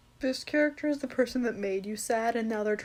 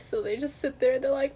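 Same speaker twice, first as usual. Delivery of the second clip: ordinary talk, nothing marked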